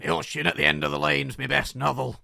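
slow, loud caricature of an author's voice; Neil Gaiman impression. Co-host mimics the author naming his best book, slow loud impression. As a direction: exaggerate the author's speech, stretched out and loud. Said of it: "That's how he talks".

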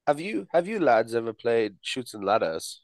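slow mock British; British accent. Asking friends about a game, slow mock British. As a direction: ask the lads slowly in put-on British. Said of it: "we have to talk in a British accent".

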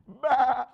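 loud high mock bleat; sheep bleat of disgust. Preacher voicing a sheep tasting flowers, loud disgusted bleat. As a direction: spit out a bad flower like a sheep. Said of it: "and you're like".

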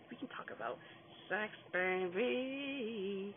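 slow, breathy mock-seductive tease; mock sultry. Teasing the audience with a mock-seductive voice. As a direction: purr it out like a cheeky come-on. Joking about talking sex.

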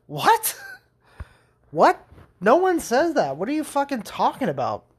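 high-pitched comic disbelief; incredulous. Retold or imitated. Viewer reacts to a nonsense TV line, incredulous.

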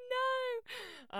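high, loud horrified no; shocked protest. Retold or imitated. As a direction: cry out in protest. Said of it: "They were like".

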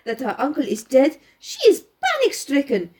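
lightly voiced French-accented detective; Poirot. Poirot laying out the girl's movements for his companion, lightly French-accented. Reads Poirot's explanation aloud.

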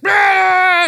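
high, loud crowd-brawl scream; comic high-pitched brawl yell; mock battle cry. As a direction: scream like a crowd charging into a fight. Imitates crowd erupting into a fight.

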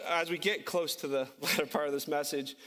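normal talking voice

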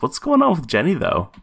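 high, reading out a note. Retold or imitated. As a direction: lift the quote high, like reading it out. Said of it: "the first thing I have written here is".

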